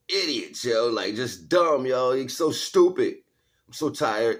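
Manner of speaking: loud scornful rant about idiots; exasperated rant. Real, not acted. Ranting to viewers, scornful and loud. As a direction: vent your contempt, loud and high.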